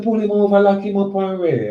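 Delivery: loud, high-pitched mock command; acted arrest order.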